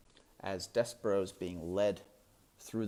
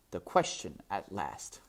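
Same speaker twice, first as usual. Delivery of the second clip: usual speaking voice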